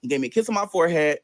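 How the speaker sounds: normal talking voice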